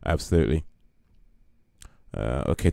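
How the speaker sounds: ordinary talk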